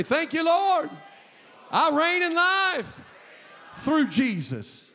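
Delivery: high, loud, slow cry of thanks; shouted praise. Acted. Pastor modelling what the congregation should shout to God. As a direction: lift it up like a victory shout. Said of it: "raise their hand right now and say".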